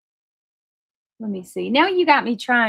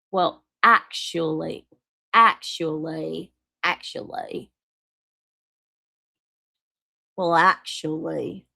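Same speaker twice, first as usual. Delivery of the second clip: slow, put-on drawn-out "actually"; mimicked "well, actually"; 3 semitones lower, slower than usual. Streamer haunted by the word actually, slow mimicking voice. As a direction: savor each syllable of a mocking "actually". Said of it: "every time I say "actually," I'm gonna think".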